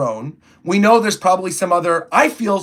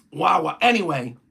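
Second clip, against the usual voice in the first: ordinary talk, nothing marked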